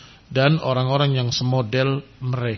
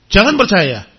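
louder, insistent repeat; firm warning; 4 semitones higher, 6 dB louder than usual. Real, not acted. Teacher warns parents not to believe, louder. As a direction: press the warning home.

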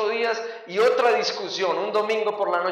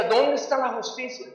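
higher-pitched indignant plea; mock-aggrieved outcry; retold protest; 5 semitones higher than usual. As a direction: cry out for justice, pitched up. Retold outcry for justice, repeated.

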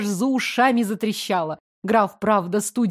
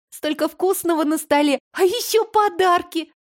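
put-on high, rising child excitement; excited girl; 7 semitones higher than usual. A narrator's reading. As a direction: bubble over with joy. Excited girl sharing joy.

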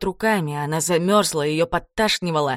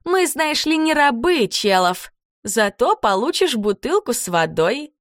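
mock waitress; 5 semitones higher than usual. A narrator's reading. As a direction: play a cruel fake-polite waitress. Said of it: "добавила Карабелла".

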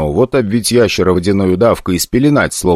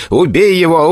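shouting kill; 9 semitones higher than usual. Acted. Igor orders the dog to kill the orc, furious shout. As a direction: spit out "убей" as a furious command. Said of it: "кричал Игорь".

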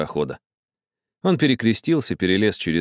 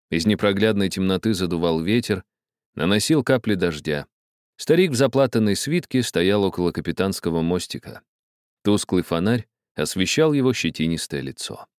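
plain reading voice

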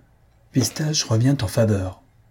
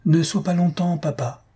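pleading; 8 semitones higher than usual. A narrator's reading. Daughter begs her departing father, pleading. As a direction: beg gently in a raised pitch. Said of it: "supplia Thalie".

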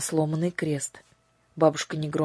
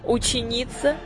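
high, commanding fairy-queen voice; imperious sorceress; 6 semitones higher, 3 dB louder than usual. A narrator's reading. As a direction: proclaim it from the throne. Lady Batp speech.

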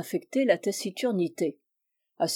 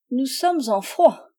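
prim, pinched reply; 4 semitones higher, 4 dB louder than usual. Acted. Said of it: "d'un air pincé".

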